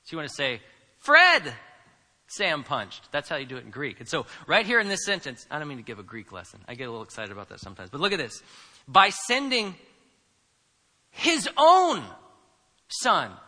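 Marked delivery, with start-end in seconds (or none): 1.0-3.1 big standalone Fred up front; stress on fronted Fred. Shown as a demonstration. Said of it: "throw it all the way up at the front". 8.9-13.4 his own set apart by pauses, high and loud; stress on "his own". Shown as a demonstration. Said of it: "That's what Paul's emphasizing here".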